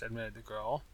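plain reading voice

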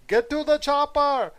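high, loud shouted Arnold impression. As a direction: bellow it like an action hero. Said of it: "and shout".

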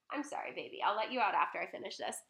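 quiet, tender aside; soothing a pet. Real, not acted. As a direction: gently reassure your dog. Soothes her sad-looking dog.

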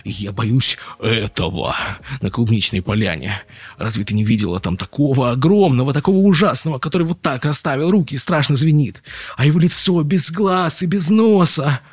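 frightened description rising in pitch and volume; agitated fear. A narrator's reading. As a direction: dread building to high, loud alarm. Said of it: "Его бросило в дрожь от волнения".

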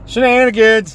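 loud playful yell; excited shout. Acted. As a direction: bellow it with glee. Echoes a funny word loudly.

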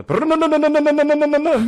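loud, high, rapid engine roar; engine revving. Acted. Telling a friend he got fired up again, screaming engine noise. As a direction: rev it hard like a motor racing. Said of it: "かかってきて".

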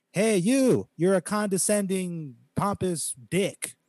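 high, drawn-out mocking chant; mock group call-out. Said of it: "Let's all say it".

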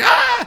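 very high mock scream. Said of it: "the guy's like".

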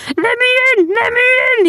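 shrieked, very high plea; spooked. A narrator's reading. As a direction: beg frantically to be let inside. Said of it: "suddenly got spooked".

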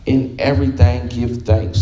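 deliberate, low-pitched Bible reading; scripture reading. Acted. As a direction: let the verse settle, word by word. Reads scripture to congregation.

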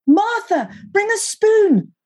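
high, urgent scream to a sister; high-pitched emergency holler; screamed call for help. A narrator's reading. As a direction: scream it high, stretching the last word. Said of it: "I screamed at my sister".

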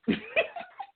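very high tipsy giggle. Real, not acted. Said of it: "I'm a little tipsy".

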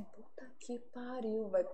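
very soft, slow swearing to herself; muttered exasperation. Acted. Cursing privately at someone's botched work, muttered exasperation. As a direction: draw the curse out under your breath. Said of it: "tem coisa que eu falo assim".

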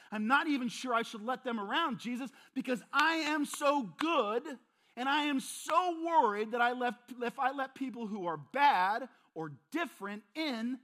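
loud, high, preening self-praise to Jesus; smug self-righteous believer. Acted. Smug believer boasting to Jesus, loud and preening.

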